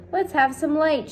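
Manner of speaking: high, loud call for light; shouted. A narrator's reading. Centipede calls out in the dark room, loud shout. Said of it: "shouted the centipede".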